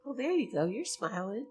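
ordinary talk, nothing marked

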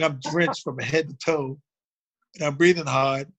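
ordinary talk, nothing marked

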